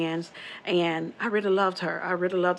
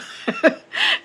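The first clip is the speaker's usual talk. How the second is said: high airless burst of laughter; breathy nervous laugh; 6 dB louder than usual. Real, not acted. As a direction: laugh off the creepiness.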